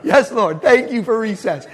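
laughing high through the words. Real, not acted. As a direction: giggle out the joke to the crowd. Laughing joke continues.

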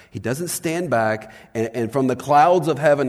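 normal talking voice

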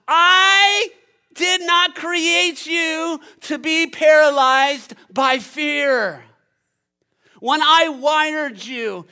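high, loud, slow proclamation; God thundering from heaven. Acted. God rebuking the fearful people he created, thunderous forceful shout. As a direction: thunder each phrase with full preaching force. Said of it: "God Almighty in heaven".

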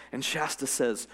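usual speaking voice

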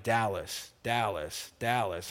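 low, quiet repeated calling; a hushed voice calling his name in the night; a low soft voice calling in the dark; God calling softly. Retold or imitated. God's voice calling him at night.

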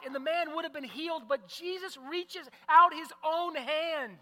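loud, high-pitched preaching at full force; predicazione appassionata. Real, not acted. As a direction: preach it out to the whole congregation, driving the key words.

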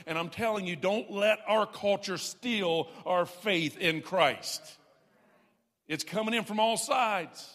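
loud, driving pulpit preaching; climbing sermon declaration; full-force preaching. Acted. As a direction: preach it hard to the congregation.

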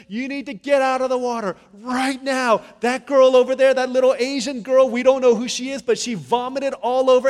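high, full-force alarm shout; urgent shouted warning. Retold or imitated. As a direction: yell a frantic evacuation order across the pool. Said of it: "there was urgency. I didn't say it like that".

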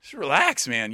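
half-amused disbelief at an overwrought poet; jokey exasperated reaction; mock exasperation. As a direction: tell a melodramatic friend to calm down. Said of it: "sometimes I read these and I'm like".

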